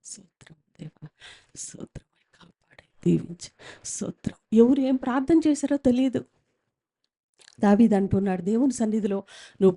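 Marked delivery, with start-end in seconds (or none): 0.0-4.4 whispered mumbled prayer; mock a shy believer's whisper; soft unvoiced praying, much softer; inaudible whispered prayer. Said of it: "ఆ voice ఎలా ఉంది?".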